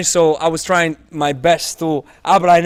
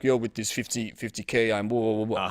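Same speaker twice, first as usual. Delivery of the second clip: low, softer mock show-off voice; mock cocky bragger; 7 semitones lower, 8 dB quieter than usual. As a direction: play a smug guy bragging about followers. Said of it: "be like".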